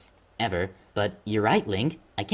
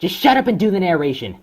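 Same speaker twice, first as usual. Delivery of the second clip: angry order; 6 semitones higher, 6 dB louder than usual. Acted. Bullying the narrator.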